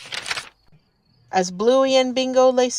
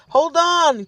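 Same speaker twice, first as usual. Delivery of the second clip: raised urgent call to hold on; urgent cry; 6 semitones higher, 3 dB louder than usual. A narrator's reading. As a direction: call out as the train jolts. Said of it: "cried Dad".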